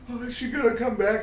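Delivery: put-on high little-kid voice; high worried child's voice; little brother. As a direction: ask it like a worried small child. Young brother crying.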